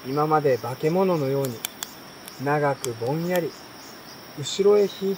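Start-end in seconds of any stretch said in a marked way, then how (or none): none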